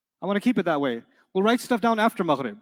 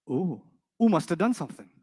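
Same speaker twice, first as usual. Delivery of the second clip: usual speaking voice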